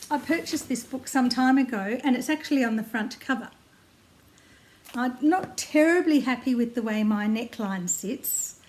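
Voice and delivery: usual speaking voice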